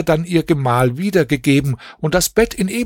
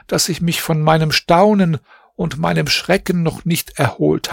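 put-on higher, shaken young princess voice; plead, still stunned by the night; anxious young princess begging forgiveness; frightened princess; 5 semitones higher than usual.

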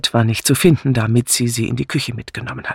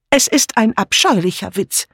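energetic; 8 semitones higher than usual. Acted. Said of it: "erklärte Dora energisch".

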